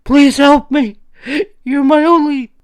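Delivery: high, loud desperate plea; terrified begging. A narrator's reading. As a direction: beg through terror. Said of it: "she begged again".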